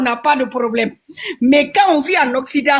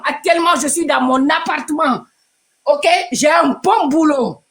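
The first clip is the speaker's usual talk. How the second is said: ordinary talk, nothing marked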